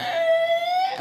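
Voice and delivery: very high far-off shout; a yell from outside the window; distant call. Retold or imitated. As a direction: call out from across the lot. Cousin calling from car.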